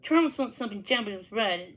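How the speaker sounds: usual speaking voice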